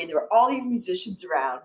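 ordinary delivery

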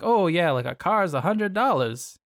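put-on high, bright old-fashioned announcer; old-timey voice. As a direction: talk like an old newsreel. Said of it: "That old-timey voice".

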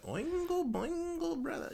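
very high, slow cartoon voice; character voice. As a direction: squeak out the name slowly. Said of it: "it's like".

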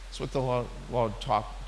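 ordinary talk

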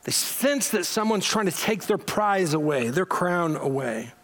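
usual speaking voice

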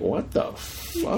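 slow, breathless whisper of shock; whispered disbelief. Retold or imitated. Fan recalling watching the show, slow whisper of disbelief. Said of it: "but it was like".

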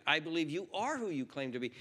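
ordinary delivery

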